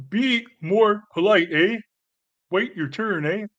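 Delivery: lampoon a prim Canadian chair calling order, eh; mock Canadian Speaker. Mocking polite Canadian parliament speaker.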